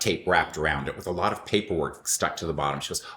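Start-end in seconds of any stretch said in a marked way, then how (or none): none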